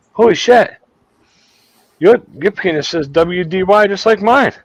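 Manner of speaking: put-on high, loud amazed Jamaican accent; Jamaican guy. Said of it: "this Jamaican guy".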